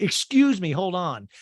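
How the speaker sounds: high, loud mock indignation; Kyle. Recapper mimicking a castmate interrupting, mock indignant. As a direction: cut in with put-on affront. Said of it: "and Kyle's like".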